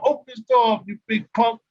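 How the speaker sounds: yelling at the teammate through the door; mock yell. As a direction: call out like banging on his door.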